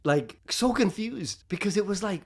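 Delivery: ordinary talk, nothing marked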